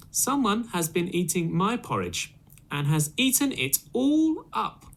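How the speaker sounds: put-on high baby bear, squeaking up on all up; little baby voice. Baby Bear finding his porridge eaten, high squeaky baby voice. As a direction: climb very high like a wailing cub. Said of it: "in a little baby voice".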